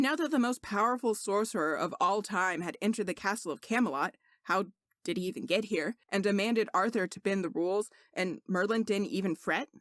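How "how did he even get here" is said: ordinary delivery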